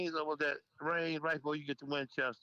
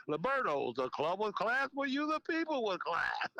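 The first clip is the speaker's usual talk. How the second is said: slow, higher radio announcer pitch; radio ad read; 4 semitones higher than usual. Retold or imitated. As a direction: sell the club like a smooth radio spot. His own radio ad slogan.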